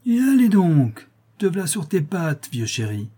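triumphant cry. A narrator's reading. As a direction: crow in triumph. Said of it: "elle s'écria triomphalement". (s'écrier triomphalement, fort et aigu)

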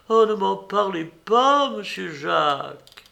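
voix aiguë et forte de commère bouleversée; cuisinière affolée. A narrator's reading. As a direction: plus haut, encore secouée. (Marie-Jeanne gémit auprès de Jacques, aiguë)

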